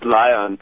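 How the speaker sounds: Leon said like "lion"; name pronunciation correction. Shown as a demonstration. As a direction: say Leon with a long "eye" in the first syllable. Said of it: "mispronounces it. It's cool".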